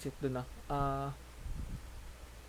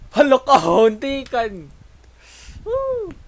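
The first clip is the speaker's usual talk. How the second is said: loud, high excited yell; excited shout; 9 semitones higher, 17 dB louder, slower than usual. Real, not acted. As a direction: shout in thrilled surprise. Near miss in game, shouting.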